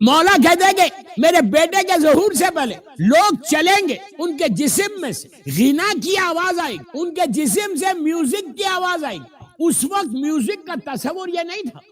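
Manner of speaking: high, forceful sermon delivery; preaching. Acted. Preaches at raised pitch. (پرجوش خطیبانہ انداز)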